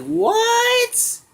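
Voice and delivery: hammed-up squeal of disbelief; Pilaf shocked. Pilaf gasps at the two Trunks, squeaking in shock. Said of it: "And Pilaf's like".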